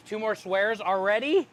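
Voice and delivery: normal talking voice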